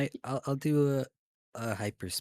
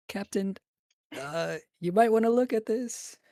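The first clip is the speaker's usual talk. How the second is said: nervous; 13 semitones higher, 3 dB louder than usual. Acted. Player voices nervous Daimi.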